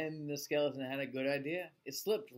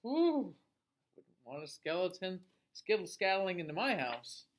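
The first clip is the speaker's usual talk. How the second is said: ordinary delivery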